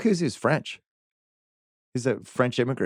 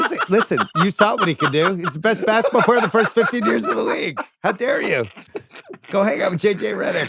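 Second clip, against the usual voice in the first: raised, laughing outrage; playful indignation; 8 semitones higher than usual. Real, not acted. Host defending an old legend to mockers, indignant. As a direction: scold them in joking offence, voice pitched up.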